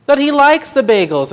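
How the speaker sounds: loud, high insistent protest; insisting. A narrator's reading. Boy insisting to grandpa that God likes bagels. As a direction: insist on it, firmly. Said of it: "insisted Benny".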